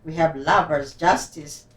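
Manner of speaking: ordinary delivery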